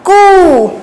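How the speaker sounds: कू with a long, held "oo"; syllable demo. Shown as a demonstration. Teacher reading out the syllable to children, कू drawn out. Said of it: "इसको क्या पढ़ेंगे हम".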